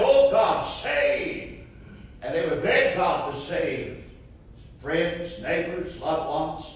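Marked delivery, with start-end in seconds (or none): 0.0-1.7 imitated crying prayer. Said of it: "you could hear them crying".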